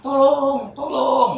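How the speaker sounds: high, loud drawn-out cry for help; long, wailing yell; screaming for help. Retold or imitated. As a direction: re-enact a desperate scream. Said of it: "Itu pas duduk teriak".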